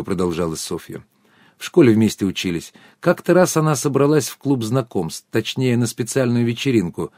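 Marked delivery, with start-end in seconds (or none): none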